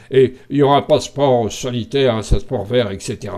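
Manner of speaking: big, gruff villain voice; Castex. Acted. Playing the baddie announcing a health passport, in a big voice. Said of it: "avec sa grosse voix".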